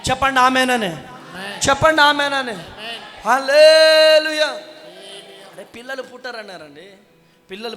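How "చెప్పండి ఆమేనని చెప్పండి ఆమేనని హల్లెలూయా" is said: loud, high, ringing preacher's call; full-force preaching. Acted. Pastor rousing the congregation to clap, preaching at full force. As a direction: rouse the crowd like a revival pastor.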